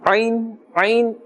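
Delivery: Ain squeezed from the middle of the throat; emphasised ain. Shown as a demonstration. Teacher stresses the letter for learners, throaty emphasised ain. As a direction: press the first sound of ain in the throat. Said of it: "we must put a little bit more emphasis on the ain".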